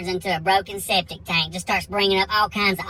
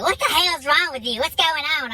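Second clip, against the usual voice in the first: high-pitched, exasperated wife voice; pissed-off wife; 8 semitones higher than usual. Retold or imitated. Wife storming out at husband, high and pissed. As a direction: imitate a wife fed up and demanding answers. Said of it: "now she's pissed".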